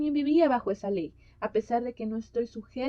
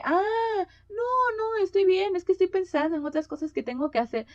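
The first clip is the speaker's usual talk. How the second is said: a much higher, louder hurried brush-off; flustered denial; 8 semitones higher, 5 dB louder than usual. Retold or imitated. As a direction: wave it off quickly, caught off guard. Lady hastily denies sadness.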